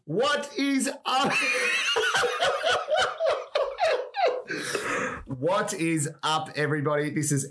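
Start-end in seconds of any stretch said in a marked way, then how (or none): 0.1-1.3 drawn-out laughing "what is up?"; excited laughing shout. Real, not acted. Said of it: "That's not how we do the intro". 1.4-5.3 squealing burst of laughter; high laughing shriek. Real, not acted. Giggling fit after botched intro.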